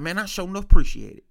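a hint of grateful granddad, fast and loud; imitating granddad.

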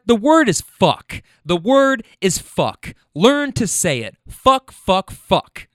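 the sixty-five-year-old client insisting, loud mock sternness; emphatic retold. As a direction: drill the word fuck like a lesson. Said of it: "She goes".

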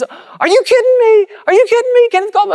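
high, loud astonished squeal, far above his own voice; amazed squeal. Retold or imitated. Tech rep hearing the Copeland name, amazed high squeal. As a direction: squeal like a starstruck fan.

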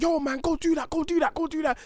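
high, egging-on mate voice; hype friend voice. Retold or imitated. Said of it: "and all your boys like".

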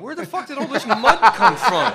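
estuary locals shouting about the mud, hammed-up outrage; mock outraged shout. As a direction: yell in disbelief at the mud.

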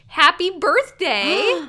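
loud, high, drawn-out birthday wish; celebratory shout-out. Pretend. Co-host hailing the long-dead theorist, celebratory and loud. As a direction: stretch it out like a cheerful cheer.

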